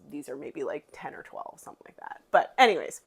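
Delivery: ordinary talk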